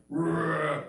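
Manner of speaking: mock monster roar; loud roar. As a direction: roar like a beast. Baby's gas roars again.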